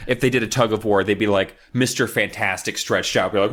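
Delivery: ordinary talk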